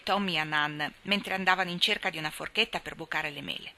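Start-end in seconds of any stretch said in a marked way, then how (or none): none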